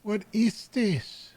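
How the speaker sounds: high, drawn-out appalled questions; appalled disbelief. Real, not acted. Reacting to disturbing pictures in game.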